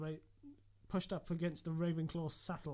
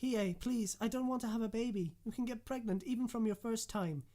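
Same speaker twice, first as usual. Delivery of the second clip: pleading; 7 semitones higher, 3 dB louder than usual. Acted. Pleading with Hiei.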